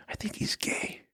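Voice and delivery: slow, hushed aside; confiding half-whisper. Retold or imitated. Singer slyly confides in his assistant about the manager, half-whispered. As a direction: imitate someone quietly leaking gossip.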